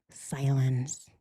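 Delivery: soft whispered word; whispered silence. Shown as a demonstration. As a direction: breathe the word out unvoiced. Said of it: "the silence is because I will be thinking".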